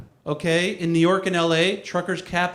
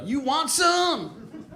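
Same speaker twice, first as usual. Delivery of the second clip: very slow, high-pitched taunt; professional wrestler; 10 semitones higher, much slower than usual. Acted. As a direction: stretch it out like a hammed-up wrestler. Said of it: "Like a professional wrestler".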